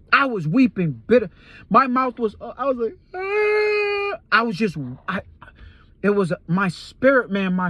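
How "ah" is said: sudden high wail; choked high wailing cry; anguished cry. Retold or imitated. Crying out, weeping in dream.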